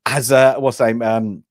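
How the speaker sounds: normal talking voice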